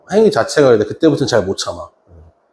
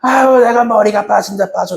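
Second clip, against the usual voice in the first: high, slow exasperated teacher nagging; scolding teacher; 9 semitones higher than usual. Retold or imitated. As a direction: nag the kid like a fed-up tutor. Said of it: "이게 아니야".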